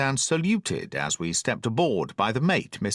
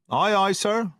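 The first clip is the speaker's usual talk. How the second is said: cried reply; 9 semitones higher than usual. A narrator's reading. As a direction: sing out from the passage. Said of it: "cried the cook in the passage".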